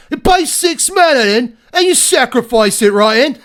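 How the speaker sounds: loud, high-pitched hammed-up Cockney; Cockney accent. Shown as a demonstration. Card reviewer showing off a Cockney for viewers, loud and exaggerated. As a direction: belt it out in broad mock Cockney. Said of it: "I can do a Cockney".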